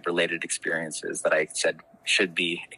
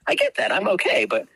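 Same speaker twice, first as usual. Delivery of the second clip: much higher, fast, pressing questions; exasperated; 12 semitones higher, faster than usual. Retold or imitated. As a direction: demand what he really means. Retelling a tense call with boss.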